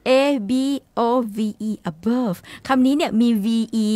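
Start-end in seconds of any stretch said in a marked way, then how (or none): 1.9-2.4 "above" said with the final V as an F, like "abof"; F sound at end. Shown as a demonstration. Host teaching Thai learners, "above" with a final F sound. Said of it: "ต้องออกเสียงเป็นตัว F นะคะ".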